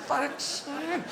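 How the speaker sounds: high, drawn-out dismay; exasperated disbelief. Acted. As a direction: slowly realise you've been fooled. Greyhound discovers the rabbit is fake.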